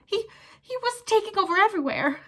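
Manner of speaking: high, breathless panic; gasped. Acted. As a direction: gasp it out. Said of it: "gasped Pettigrew".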